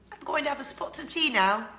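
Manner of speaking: playful put-on British accent, pitched high. Shown as a demonstration. Showing off love of speaking British, high mock British accent. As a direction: lift the pitch and play at being British. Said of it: "I love speaking British".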